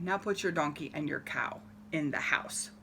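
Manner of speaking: wise man whispers low; whispered advice. Acted. Wise man whispers his odd advice to the farmer. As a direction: murmur it like a secret.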